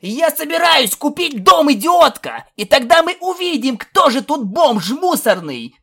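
high, loud quarrelling shout; furious yelling. A narrator's reading. Colt shouts back at insulting filly, furious yelling. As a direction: yell the threat at full pitch. Shouting match over buying a house.

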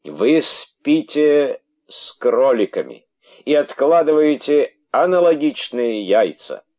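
high, loud, slow accusation; furious king's verdict. Acted. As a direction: spell out the betrayal. King accuses the females.

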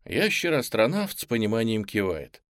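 ordinary delivery

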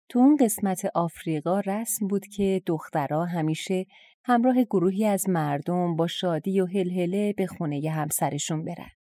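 usual speaking voice

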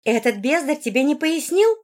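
acted gruff outrage at someone's incompetence; rough indignation. A narrator's reading. Margarita blaming the companion for not explaining, rough indignation. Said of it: "грубовато возмутилась девушка".

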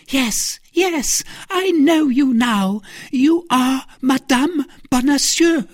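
very high, mounting exclamation; high-pitched triumphant realisation; excited recognition. A narrator's reading. Milady recognises her rival, exclaims.

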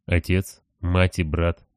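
ordinary delivery, nothing marked

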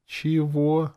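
very high, timid interjection; small high bewildered question. A narrator's reading. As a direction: try meekly to interrupt. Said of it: "сделала она робкую попытку".

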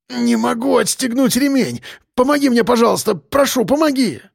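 high, strained pleading; panicked babbling. A narrator's reading. Trapped driver begging for help with the belt, panicked. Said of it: "лепетал Белобрысый".

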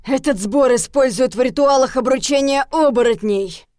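heated, forceful outburst; growling anger. A narrator's reading. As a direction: snarl out the accusation. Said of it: "Зарычав".

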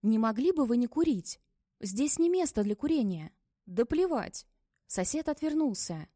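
ordinary delivery

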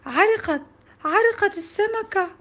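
put-on high, loud child's cry of alarm; acted scream. A narrator's reading. Child shouting that the class fish drowned, acted scream. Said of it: "صرخت بأعلى صوتي".

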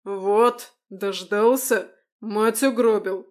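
loud, slow angry outburst; angry exclamation. Acted. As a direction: shout the accusation at him. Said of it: "воскликнул отец".

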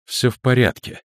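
quick surface reassurance; reassuring. A narrator's reading. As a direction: claim to be fine. Spoken reassurance, contrasted with thought.

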